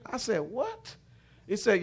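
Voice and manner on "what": high rising question, acted astonishment; startled disbelief. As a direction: pitch it way up in surprise. Startled reply to unexpected praise.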